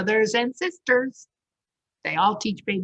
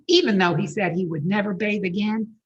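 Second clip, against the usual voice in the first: ordinary delivery, nothing marked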